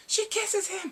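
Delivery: very high, loud squeal of glee; excited squeal. Real, not acted. Excitedly announcing the kiss.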